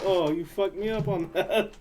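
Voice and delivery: slow, laughing fake complaint; laughing mock lament. Friend mock-whining through laughter after a sad movie. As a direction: whine it through a laugh, teasing.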